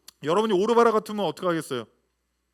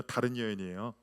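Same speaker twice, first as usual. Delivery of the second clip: ordinary talk, nothing marked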